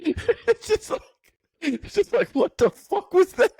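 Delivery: very high, squeaky laughing voice; helpless laughter. Real, not acted. Friend picturing a passerby's shock, cracking up. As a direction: squeeze the words out through laughter.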